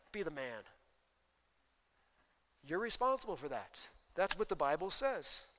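usual speaking voice

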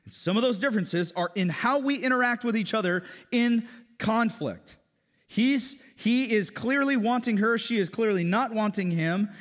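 loud, high, impassioned sermon; full-force preaching. Acted. Pastor telling congregation men and women differ, fervent. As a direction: drive the point home.

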